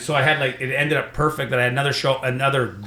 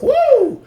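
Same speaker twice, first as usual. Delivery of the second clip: high, loud "whoo"; triumphant whoop; 8 dB louder than usual. Retold or imitated. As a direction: let out a high celebratory whoop. Retold relief after sure win.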